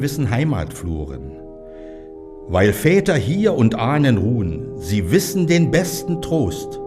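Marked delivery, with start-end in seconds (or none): none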